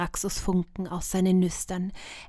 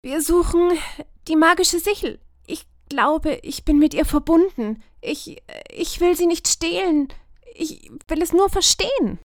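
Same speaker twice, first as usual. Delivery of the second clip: higher, pleading, sincere; earnest girl; 9 semitones higher, 4 dB louder than usual. A narrator's reading. Lumina explaining herself to the druids, higher and earnest. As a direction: plead your case honestly.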